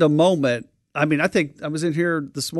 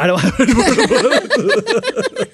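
slow, laughing echo; 5 semitones higher, slower than usual. Real, not acted. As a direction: repeat it through a laugh. Repeats S2's line, laughing.

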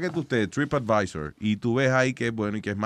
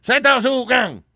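loud mock Chinese; 10 semitones higher, 6 dB louder, slower than usual. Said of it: "Eso es en chino".

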